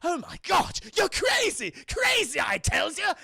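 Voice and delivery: high, loud cartoon panic; hammed-up frantic villain outcry; Joker. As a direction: squawk it in mock alarm. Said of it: "Joker's like".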